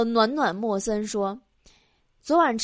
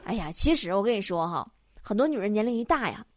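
usual speaking voice

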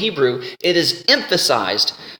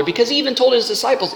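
usual speaking voice

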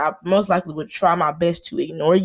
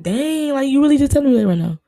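higher, louder and fast disbelief; incredulous quote; 6 semitones higher, 4 dB louder than usual. Retold or imitated. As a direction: blurt it out, stunned and loud. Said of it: "Or like".

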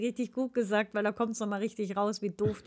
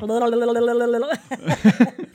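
tongue trill warm-up; 8 dB louder than usual. Acted. Warming up voice, trilling.

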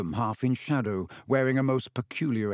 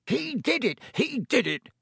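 much higher, furious spitting accusation; spat; 12 semitones higher than usual. A narrator's reading. Caretaker accusing Harry, spitting with rage. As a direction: spit it out in rage. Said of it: "Filch spat".

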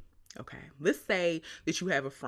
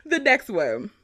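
ordinary talk, nothing marked

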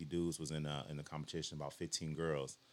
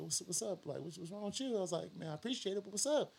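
retold surprise; 13 semitones higher than usual.

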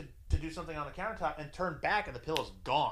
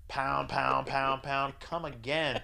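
ordinary delivery

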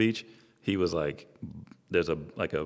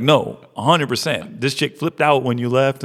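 louder, higher retold protest; emphatic insistence; 6 semitones higher, 5 dB louder than usual. Friend insisting the girl flipped out, emphatic. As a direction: imitate a buddy heatedly backing up his story.

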